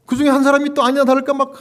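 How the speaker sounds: normal talking voice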